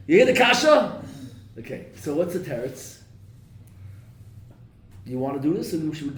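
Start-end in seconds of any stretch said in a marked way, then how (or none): 0.1-1.0 high, loud challenge; emphatic challenge. Real, not acted. Rabbi pressing his students to see the question, loud and high. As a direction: demand they feel the question.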